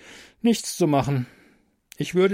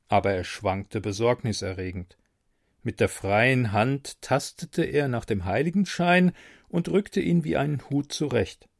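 ordinary delivery

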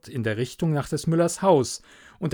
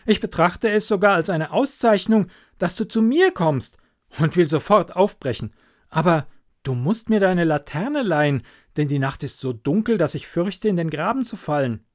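much higher and louder, keen; eager little Hans; 4 semitones higher, 3 dB louder than usual. Acted. Hans agreeing to fetch the doctor, higher and louder. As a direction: sound honored and ready to go.

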